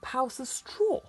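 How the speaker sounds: disbelieving, high and loud; can you believe this; incredulous. A narrator's reading. Wolf indignant aside to reader.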